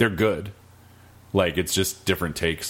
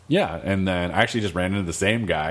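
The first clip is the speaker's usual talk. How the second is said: normal talking voice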